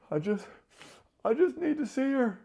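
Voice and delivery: high, sniffly imitation of crying; mock tearful pleading. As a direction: plead like you've cried all day, hammed up. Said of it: "snot sniffles from crying all day".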